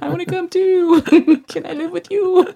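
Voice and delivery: put-on high squeaky kid voice; childlike pleading. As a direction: beg like an excited child. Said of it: "And I'm like".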